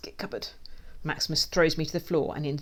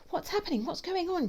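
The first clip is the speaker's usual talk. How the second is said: very high, cross questions; cross snap. A narrator's reading. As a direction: demand answers crossly, without raising the volume. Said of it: "she snaps crossly".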